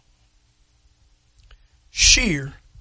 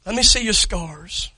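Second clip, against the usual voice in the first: normal talking voice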